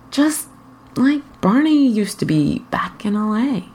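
plain reading voice

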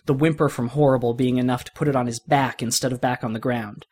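plain reading voice

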